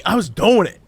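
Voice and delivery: normal talking voice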